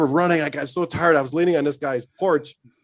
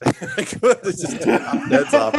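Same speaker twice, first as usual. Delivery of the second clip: high, laughing through the words; 9 semitones higher, 4 dB louder than usual. Real, not acted. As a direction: crack up while answering. Laughing reply.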